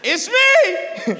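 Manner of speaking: high shouted reply; Jesus. Acted. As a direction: call back across a long distance. Jesus answers across the water.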